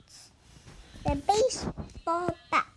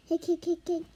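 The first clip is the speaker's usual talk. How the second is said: hiccups; faster than usual. Acted. Character has hiccups.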